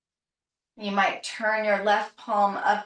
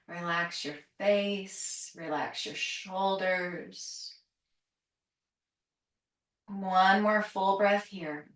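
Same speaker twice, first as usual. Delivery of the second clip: soft relaxation cues; 4 semitones lower, 4 dB quieter than usual. Acted. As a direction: ease them into letting go. Soothing relaxation cues near session end.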